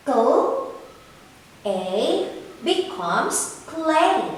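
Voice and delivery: slow sounding out, then "clay" said whole; blending sounds. Shown as a demonstration. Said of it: "Can you read it with me".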